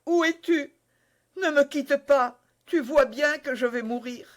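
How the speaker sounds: high, pleading cry; frightened dying woman's plea. Acted. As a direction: beg him not to leave.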